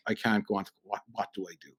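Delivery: quiet panic. Retold or imitated. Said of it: "And I'm like".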